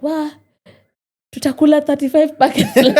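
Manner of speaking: high, loud and laughing, mimicking mom; mom's laughing exclaim. Mom teasing her daughter about leftover food, laughing. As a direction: echo mom's amused disbelief in Swahili. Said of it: "so my mom is like".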